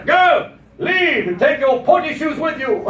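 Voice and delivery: high-pitched, indignant send-off; hammed-up order to get out; mock British dismissal. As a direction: banish them like an exasperated Briton. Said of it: "for the British to throw you out".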